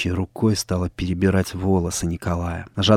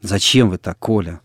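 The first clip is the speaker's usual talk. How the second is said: ordinary delivery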